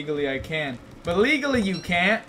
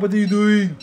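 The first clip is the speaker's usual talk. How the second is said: usual speaking voice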